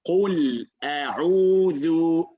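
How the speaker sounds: Qul A'udhu held long and slow; slow model recitation. Shown as a demonstration. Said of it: "Brother [name]?".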